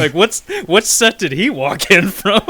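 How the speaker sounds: can't stop laughing while asking; laughing disbelief. Real, not acted. Mocking the stray extra, laughing hard.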